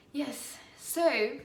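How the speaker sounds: light British accent. Real, not acted. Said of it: "I'm back to my British accent".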